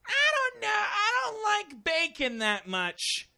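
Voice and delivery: put-on high, slow whiny little-girl voice; whiny child voice. Comedian imitating a little girl snubbing the bacon pie, high slow whine. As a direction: whine like a picky kid refusing bacon. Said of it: "and she goes".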